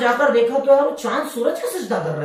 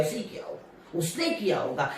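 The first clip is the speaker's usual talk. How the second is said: a sly murmur passing judgement on someone; hushed, low accusing whisper; gossipy whisper; 7 semitones lower, 13 dB quieter than usual. Retold or imitated. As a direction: whisper it, lower and much softer. Gossiping assumption about others.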